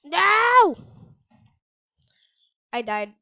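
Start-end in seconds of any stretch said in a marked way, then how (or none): 0.0-0.8 shouted no, higher; loud shout. Real, not acted. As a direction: yell it in dismay. Dying in game.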